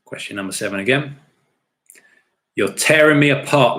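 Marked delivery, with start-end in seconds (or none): 2.6-3.8 loud, raised cry of anguish, hammed up; dramatic movie outburst. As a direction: cry out the movie line, punching TEARING. Repeats the acted quote.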